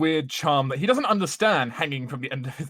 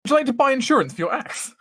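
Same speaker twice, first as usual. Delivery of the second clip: shopkeeper voice; 11 semitones higher, 4 dB louder than usual. Acted. Shopkeeper upsell voice.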